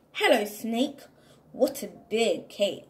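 ordinary delivery, nothing marked

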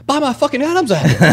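high, loud mock outrage; retold outraged protest. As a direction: yell it in comic indignation. Retold protest about crushed throat.

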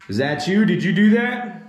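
high, loud startled questions; jolted by a sudden scare. Real, not acted. Camera drops suddenly.

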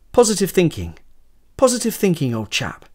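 put-on a loud, jolly pep talk to an old friend; hearty encouragement. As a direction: boom it out to lift his friend's spirits. Cheering up his friend.

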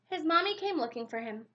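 usual speaking voice

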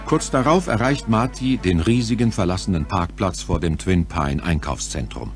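normal talking voice

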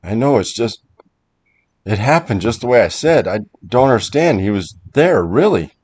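urgent, emphatic account of a scare; agitated insisting. Acted. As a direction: plead to be believed. Protests that it really happened.

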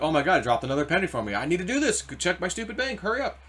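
speeding up as the price drops; excited. Retold or imitated. Retelling his dream, he hurries to check his bank, excited and rising. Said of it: "now I'm, like, excited".

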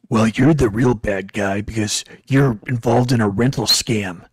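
low, loud mock voice of an opponent; loud, deep-voiced impression of an arguer; imitated confrontational man. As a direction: challenge him in a mock deep voice. Imitating the man's accusation.

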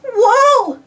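a high, loud whoa as the sub rocks; startled call. A narrator's reading. Cartoon jolt of surprise, high and loud. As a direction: submariner Tasha thrown about by the rocking sub, startled whoa. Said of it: "called out submariner Tasha".

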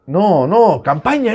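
alarmed cry, pitch climbing fast; alarma repentina. Real, not acted. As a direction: panic as the play goes wrong. Alarm at keeper's mistake. (alarmado, subiendo el tono)

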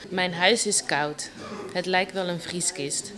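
soft, understated poem recital; quiet spoken poetry in measured phrases; poem reading. Acted. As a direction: read your poem softly, pausing between lines. Recites her own poem.

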